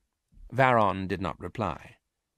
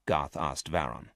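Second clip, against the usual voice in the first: ordinary delivery, nothing marked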